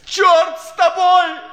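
slow, loud, high curse; furious outburst. Acted. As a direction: spit the curse out slowly. Thrown out, final outburst.

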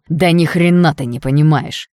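angry shout, slow and loud. Acted. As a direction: yell it at her in fury. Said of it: "выкрикнул он".

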